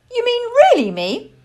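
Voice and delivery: high, loud squeak of surprise; astonished little child's voice; amazed owlet. A narrator's reading. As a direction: ask it in delighted disbelief. Owlet amazed at being chosen.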